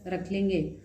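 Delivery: ordinary talk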